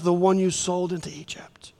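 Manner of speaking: whispered secret, unvoiced. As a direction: breathe it so only they hear. Joseph's secret whispered to brothers.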